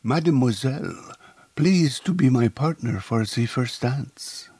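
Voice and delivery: put-on a suave Frenchman asking a lady to dance, French accent. A narrator's reading. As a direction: charm her in a French accent. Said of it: "With a suave French accent".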